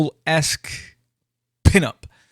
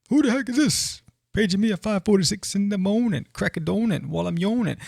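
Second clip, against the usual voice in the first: grumpy woken character; 7 semitones higher than usual. Acted. Woken character grumbling at pager.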